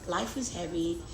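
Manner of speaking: ordinary talk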